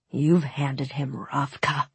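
slow whisper, almost voiceless; hushed accusation. Acted. Nikolai to his brother as alarm bells ring, slow whisper. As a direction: whisper it slowly, with weight.

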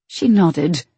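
ordinary delivery

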